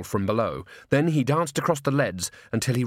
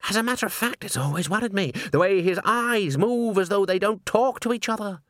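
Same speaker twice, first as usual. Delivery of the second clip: high-pitched, confiding busybody; gossiping chorus member; 8 semitones higher than usual. A narrator's reading. Chorus member airing worries about Walter, gossipy. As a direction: share a misgiving in a raised voice.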